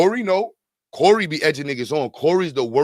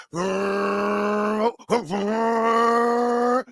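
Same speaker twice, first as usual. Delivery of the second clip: rising mock engine roar, higher; engine revving; 5 semitones higher, much slower than usual. Imitating a reckless driver's car, rising engine roar.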